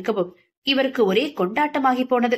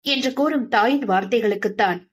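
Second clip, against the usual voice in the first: ordinary delivery, nothing marked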